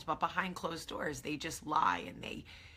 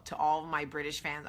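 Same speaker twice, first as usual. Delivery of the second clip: ordinary talk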